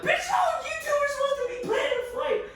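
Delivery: high, loud squeal of protest; indignant yell. Retold or imitated. Narrator to dad and sister, high squealed protest. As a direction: shriek it back in outrage. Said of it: "I'm like".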